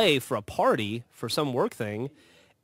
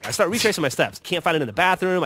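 ordinary talk

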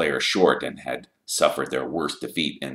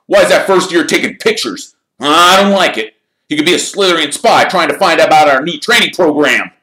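loud, high, agitated captain; worked-up accusation of spying; suspicious complaint; 12 semitones higher, 14 dB louder, faster than usual. A narrator's reading. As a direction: object loudly, voice pitched up. Suspicious captain challenges the photographer.

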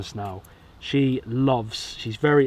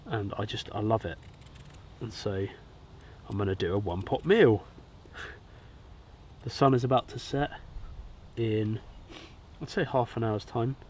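stuffy, congested voice; blocked nose; 7 dB quieter than usual. Real, not acted. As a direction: talk through a head cold. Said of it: "Apologies if I sound like I've got a clothes peg on my nose".

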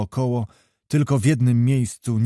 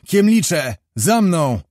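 higher, louder rallying call to his men; shouted order; 5 semitones higher, 4 dB louder, slower than usual. A narrator's reading. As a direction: summon them as you spur the horse. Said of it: "krzyknął".

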